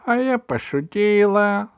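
high, loud, drawn-out witch voice; flustered witch. A narrator's reading. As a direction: backpedal fussily in a high voice. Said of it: "засуетилась ведьма". (суетливая ведьма, высоким голосом)

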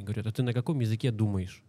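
usual speaking voice

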